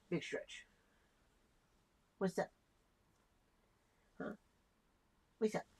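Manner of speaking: soft unvoiced whisper to the cat. Real, not acted. Owner whispering to his stretching cat, unvoiced. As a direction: breathe it out without voice.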